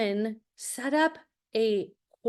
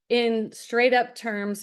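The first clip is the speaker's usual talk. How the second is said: ordinary talk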